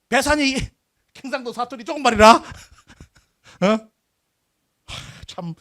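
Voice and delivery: laughing through the words; can't help laughing as you say it; laughing in disbelief. Real, not acted. Lecturer ridiculing the Busan history's dialect claim, laughing in disbelief.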